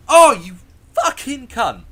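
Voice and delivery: very loud, high mock fury; hammed-up furious shout; outraged swearing. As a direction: yell it as a retold reaction to a jump scare. Retells swearing at man who scared him.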